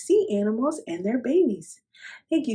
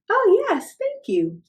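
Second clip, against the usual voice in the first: delighted yes; 12 semitones higher, 5 dB louder than usual. Acted. Missus Seahorse accepts help gladly.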